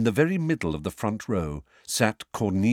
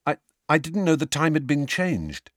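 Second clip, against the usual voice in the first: higher, stammering nervous excuse; 8 semitones higher than usual. A narrator's reading. Harry excusing his lateness to the court, nervous. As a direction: stammer it out, pitched up with nerves. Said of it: "said Harry nervously".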